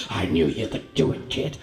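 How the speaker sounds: low, gruff-hearted praise; low-voiced congratulation from a tough mentor; approving gunman. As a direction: drop deep for the biker's approval. Gunman praising him, voice dropped low.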